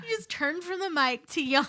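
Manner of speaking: loud laughing tease; teasing through laughter. Real, not acted. Teasing about the yawn.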